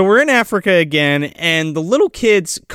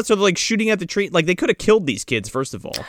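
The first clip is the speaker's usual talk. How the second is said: ordinary delivery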